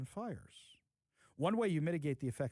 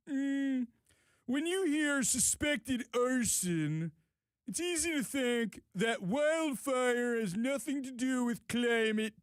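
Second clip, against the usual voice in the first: slow, higher mock Inslee voice; Jay Inslee voice; 5 semitones higher, slower than usual. Host reading the governor's post, mocking him in a slow higher voice. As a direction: drag each phrase out like a pompous governor. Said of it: "Should I put on my best Jay Inslee voice".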